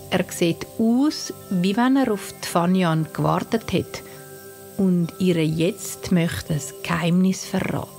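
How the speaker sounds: usual speaking voice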